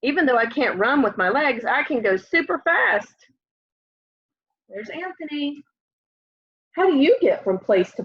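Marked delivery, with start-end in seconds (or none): none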